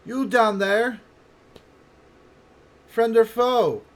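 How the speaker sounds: high, loud call from above; projected, high-pitched challenge; female voice hailing. Acted. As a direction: hail strangers far below in a woman's voice. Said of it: "somebody hailing you".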